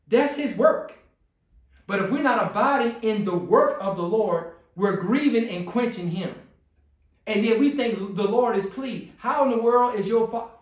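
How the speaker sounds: impassioned sermon voice; preaching. Acted. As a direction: press the point like a pulpit preacher. Preaching at full force.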